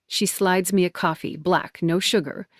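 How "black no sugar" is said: plain reading voice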